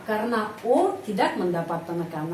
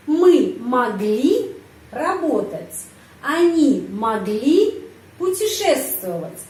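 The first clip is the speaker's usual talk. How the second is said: slow, raised question tune on each phrase; question intonation drill; 3 semitones higher, 5 dB louder, slower than usual. Shown as a demonstration. Teacher drilling students on Russian question intonation. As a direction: pitch each question up, slowly. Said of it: "kata tanya, ya, kalimat tanya, intonasinya".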